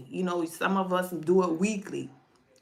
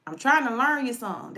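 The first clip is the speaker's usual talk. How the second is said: louder, a hint of an old grandma scolding; lightly voiced grandma teaching a lesson; great grandma insisting to her grandchild, louder; great grandma's way of talking; 5 semitones higher, 6 dB louder, faster than usual. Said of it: "That's how my great grandma used to talk".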